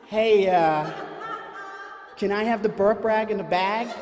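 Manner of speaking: lightly voiced wife asking for the burp rag; wife's request. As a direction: voice the wife asking across the aisle. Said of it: "I get the".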